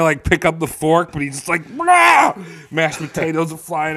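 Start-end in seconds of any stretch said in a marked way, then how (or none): 1.8-2.3 high, loud cartoon "blah"; sudden hammed-up outburst; comic flailing yell. Marty trying to pick up the fork, comic yell.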